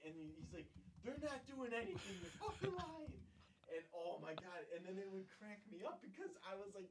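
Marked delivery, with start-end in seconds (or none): 1.0-3.1 high-pitched mock scolding; retold annoyance, pitched way up; exasperated brother. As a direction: imitate a fed-up brother setting him straight.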